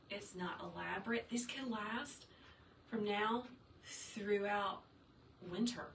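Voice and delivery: usual speaking voice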